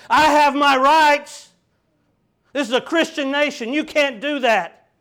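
indignant protest. Pretend. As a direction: bellow like someone whose rights were trampled. Mocking indignant Christians protesting.

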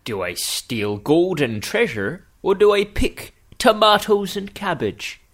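put-on Southwestern English accent. Pirate or farmer weighing gold against cabbage, in Southwestern English. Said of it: "Southwestern English".

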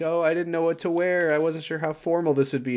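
put-on girlish fluster. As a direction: flutter it shyly. Amanda flustered.

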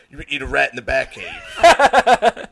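slow, loud mock command; slow mock-heroic bellow; Batman. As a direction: drag out a hammed-up tough-guy order. Mock Batman ordering the kid.

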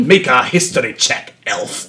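a hint of a disdainful dwarf; Gimli-style gruff dwarf. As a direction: growl out the history check at the elf. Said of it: "It's very Gimli of me".

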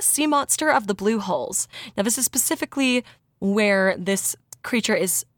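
ordinary talk

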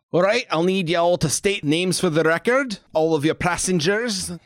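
high, loud guard calling up; gruff dwarf guard. Acted. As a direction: shout up like a gate captain. GM voices dwarf guard shouting.

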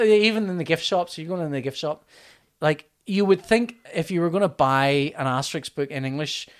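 ordinary delivery

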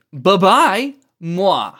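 high, loud cutesy goodbye; playful mock-sweet send-off; taunting farewell. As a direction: sing out a teasing goodbye and blown kiss. Villain's taunting farewell with kiss.

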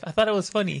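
high, loud protest; imitating a kid defending himself; mock pleading excuse. Repeats his excuse to teacher.

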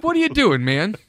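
high, loud scolding yell; scolding the dog. Acted.

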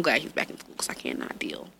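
soft whispered aside. Real, not acted. Mother confessing she can't cope, whispered. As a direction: confide it under your breath. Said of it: "whispered aside".

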